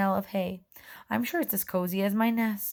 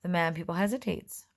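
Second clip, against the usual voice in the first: usual speaking voice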